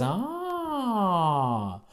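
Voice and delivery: soft awed ah sliding down; crowd ah. Shown as a demonstration. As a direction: model an amazed ah for the crowd. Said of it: "And everyone says".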